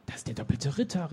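understated gasp of recognition; surprised exclamation. A narrator's reading. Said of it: "rief sie".

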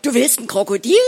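loud, aghast question; horrified disbelief. A narrator's reading. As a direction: blurt it out, appalled. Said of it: "Entsetzt starrte ich sie an".

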